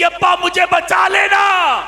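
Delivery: long, slow, very high shouted wail of 'अब्बा, मुझे बचा लेना'; daughter's cry for help. Retold or imitated. Preacher voicing the drowning daughter begging her father, howled high. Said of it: "वो मुझे कहती".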